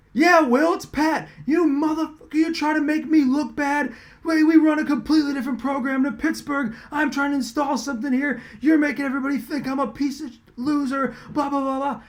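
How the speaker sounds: high, heated ranting coach; retold angry rant. Coach berating the reporter over the article, heated. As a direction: vent like a furious coach.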